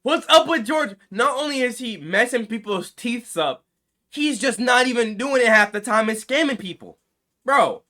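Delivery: loud exasperated rant at scammers; outraged rant. Real, not acted. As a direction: blast it out in disbelief. Ranting about Georgia scammers.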